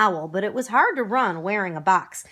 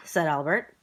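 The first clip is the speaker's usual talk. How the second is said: ordinary delivery, nothing marked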